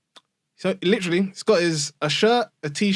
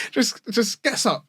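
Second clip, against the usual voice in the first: ordinary talk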